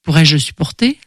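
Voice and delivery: usual speaking voice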